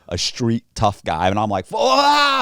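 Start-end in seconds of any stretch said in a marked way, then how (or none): 1.7-2.4 loud, high mock scream; acted yelp of alarm. Teller facing a tough guy ready to swing, loud startled yelp. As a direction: yelp as if about to get hit.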